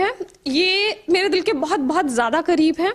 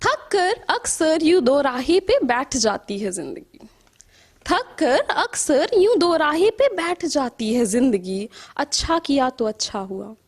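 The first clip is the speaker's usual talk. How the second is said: measured verse with rising and falling cadence; poetry recital; 4 semitones lower, slower than usual. Acted. Poet reciting to an appreciative audience, measured poetry recital. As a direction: recite in lilting lines, letting line endings sink.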